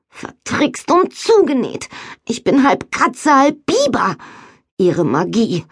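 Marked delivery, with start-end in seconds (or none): 0.1-4.2 put-on higher girlish dismay. A narrator's reading. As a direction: voice the girl's shock. Nori's dismayed thought voiced.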